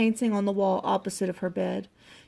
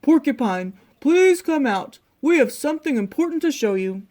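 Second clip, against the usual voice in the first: Bear; 4 semitones higher, 7 dB louder than usual. A narrator's reading. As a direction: call a friend out of the house. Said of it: "Bear called".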